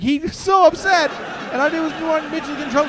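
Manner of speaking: high, loud comic agitation; worked-up shouting. Acted. Comedian retelling how upset the driver was, agitated shouting. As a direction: rattle it out at a yell.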